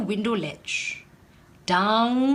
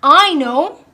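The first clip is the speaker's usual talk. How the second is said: louder, lifted "I know"; excited exclamation; 3 semitones higher, 8 dB louder than usual. A narrator's reading. Adil solving his shoe problem, excited and louder. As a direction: announce the answer with delight. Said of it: "he finally cried".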